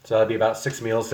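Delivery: ordinary delivery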